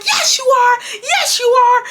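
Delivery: high, insistent mother imitation; hammed-up contradicting scold; mom going off. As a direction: fire it back as an outraged mom. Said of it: "she's just going off on me".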